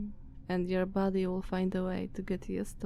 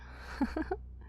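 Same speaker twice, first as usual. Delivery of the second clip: a light breathy laugh under the breath; soft breathy chuckle; 9 semitones higher, 7 dB quieter than usual. Acted. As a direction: chuckle softly at your own dark joke. Vampire amused at own joke.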